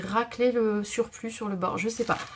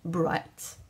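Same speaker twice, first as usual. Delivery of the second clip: Bright in a shaky English accent, lower; 5 semitones lower than usual. Shown as a demonstration. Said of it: "j'ai pas mis l'accent". (accent anglais approximatif)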